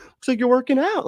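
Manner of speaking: imitated high-pitched gushing; overly nice compliment. Fitness fan complimenting a coworker, mock gushing. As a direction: play the too-nice guy, voice way up. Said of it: "being too nice and be like".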